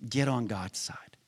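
hushed, breathy whisper of the charge. Real, not acted. Preacher urging the congregation, in a hushed whisper. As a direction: breathe the words almost silently. Repeated exhortation, whispered first.

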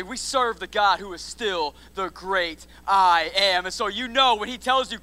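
ordinary talk, nothing marked

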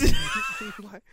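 high, loud cackle; burst of laughter. Real, not acted. Laughs at nipple joke.

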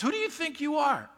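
high, squeaky put-on voice of the scoffers; mocking outrage. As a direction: repeat the taunt in a shrill caricature. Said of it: "I love this".

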